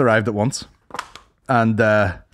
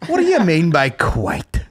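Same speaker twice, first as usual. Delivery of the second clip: slow, higher mock-offended comeback; jokingly affronted, pitched up and slow; mock indignation; 4 semitones higher, slower than usual. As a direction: play at taking offence, drawing it out. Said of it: "No, I'm joking".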